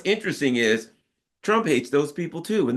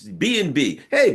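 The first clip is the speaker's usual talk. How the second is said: usual speaking voice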